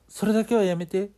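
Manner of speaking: high, loud begging; desperate plea. Acted. Ayaka begs the ghost to spare her, high and loud. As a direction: beg for mercy at the top of your voice.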